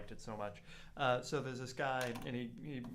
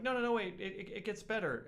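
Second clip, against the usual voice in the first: eager insisting; 8 semitones higher than usual. Retold or imitated. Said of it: "And he's like".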